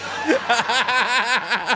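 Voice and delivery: high laugh. Real, not acted. Laughing at the joke.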